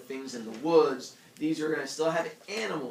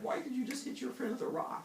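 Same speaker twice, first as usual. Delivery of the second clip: scolding elder; 12 semitones higher, 4 dB quieter than usual. Retold or imitated.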